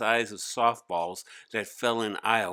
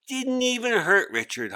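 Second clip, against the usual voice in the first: smirking; 11 semitones higher, 3 dB louder than usual. Acted.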